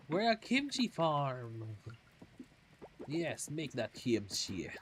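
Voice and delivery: slow, dragged-out mock Transylvanian accent; weird Transylvanian accent. Gamer cheering her kimchi farm in a mock Transylvanian accent. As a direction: lean into a hammed-up Transylvanian accent, slowly. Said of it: "a weird Transylvanian accent".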